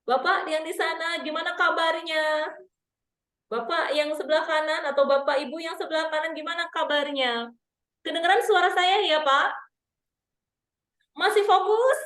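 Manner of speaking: high, loud crowd work; walk the stage and check in with the audience; engaging MC. Shown as a demonstration. MC moving around, greeting each side of the audience, high and loud. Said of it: "harus ada gestur".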